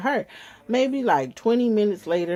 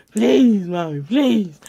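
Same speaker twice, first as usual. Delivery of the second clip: mock whiny child pleading; begging child; 3 semitones higher, 7 dB louder, much slower than usual. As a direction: plead like a little girl. Child begging mother in story.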